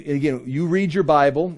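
ordinary talk, nothing marked

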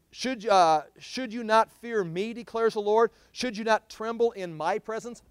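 loud, ringing preacher's reading of the prophet; scripture reading. Acted. Preacher reads Jeremiah aloud to his church, ringing proclamation.

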